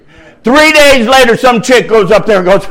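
ordinary delivery

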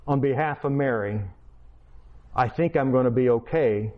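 ordinary delivery

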